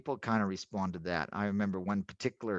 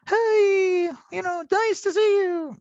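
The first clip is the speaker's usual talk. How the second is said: much higher, louder, gushing phone greeting; fake American enthusiasm; 8 dB louder, slower than usual. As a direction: overdo the cheery hello like a salesman. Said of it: "Don't sort of fake enthusiasm".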